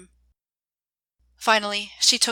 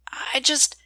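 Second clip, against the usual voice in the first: whisper; 5 semitones higher than usual. Acted. Trails off unvoiced.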